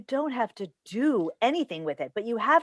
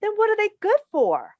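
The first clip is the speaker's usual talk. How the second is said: sharp, high, louder demand; exasperated demand; 11 semitones higher, 6 dB louder than usual. Acted. Mother pressing her son, exasperated and high. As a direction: push him hard for an answer.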